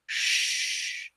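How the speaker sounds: a soft unvoiced shh; whispered shush. Cassie hushing narrator during morph, whispered shush. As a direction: hush someone quietly. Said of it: "to say, "Shh".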